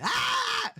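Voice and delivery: very high comic shriek; silly squealed "ah"; mock yell. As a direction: imitate a flailing guard's high yelp. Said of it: "throwing their arms and legs around and going".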